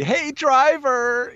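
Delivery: high, slow squealing call; drunk squealing bachelorette. Retold or imitated. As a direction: squeal it like a drunk party girl. Drunk bachelorette calling limo driver.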